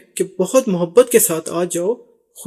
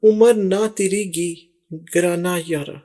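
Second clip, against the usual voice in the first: slow lyric recital; slower than usual. Shown as a demonstration. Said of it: "یہ بولا انہوں نے".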